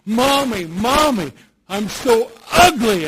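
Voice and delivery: put-on high, loud anguished cry of a child; screamed out. Little boy screams to his mother in the mirror, loud and high. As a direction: wail it like a hurt little boy. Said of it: "And he screamed out".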